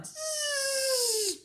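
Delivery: long high loud whine; diving mosquito whine. Acted. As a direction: hold a piercing dive buzz. Mosquito zooms down.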